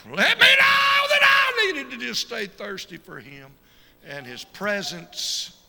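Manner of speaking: a very high, slow, full-throated preacher's cry; preaching shout. Real, not acted. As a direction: build to a climax shout. Preacher's climax shouting about thirst for God.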